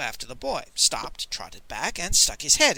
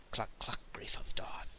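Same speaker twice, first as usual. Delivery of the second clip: breathy whispered chant, no voice; whispered charm; 5 semitones lower, 13 dB quieter than usual. Acted. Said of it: "the song charm was repeated".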